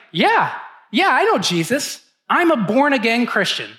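a proud new believer who knows the answer; retold excitement; excited. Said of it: "I was kind of excited".

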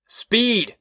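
high-pitched one-word shout. A narrator's reading. Husband warns his speeding wife, shouting. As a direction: call it out high over noise. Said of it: "he shouted".

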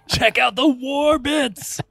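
hyped-up bro shouting about war; excited. Pretend. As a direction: pump it up like a war fan. Excited war fan hyping battles.

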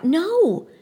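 a high, loud cry; cry of alarm. A narrator's reading. Girl crying out as rocks hit the man, high loud cry. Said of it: "The girl cries out".

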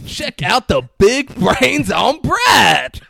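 slow, high, booming movie quote; mocking shout. Jackson's character mocking Brad's brains, loud drawn-out shout. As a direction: shout it out, drawing every word long.

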